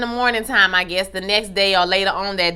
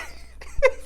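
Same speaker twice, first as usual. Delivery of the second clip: airy laugh; 6 dB quieter than usual. Real, not acted. Laughs after singing.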